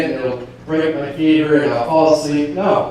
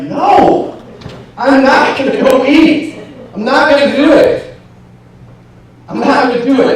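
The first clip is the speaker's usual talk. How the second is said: high, loud yelled refusal; defiant inner yell; 12 semitones higher, 7 dB louder than usual. Retold or imitated. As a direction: re-enact an inner rebellion at full volume. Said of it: "I yelled in my head".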